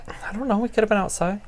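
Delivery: whispered joke; hushed, breathy aside; whispering. Real, not acted. Joking aside to cohost.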